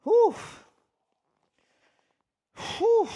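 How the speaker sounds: sharp high whew after the effort; puffing after a lift; loud blowing exhale. Real, not acted. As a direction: blow the air out hard after the rep.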